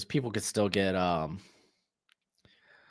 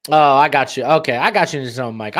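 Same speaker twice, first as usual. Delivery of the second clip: normal talking voice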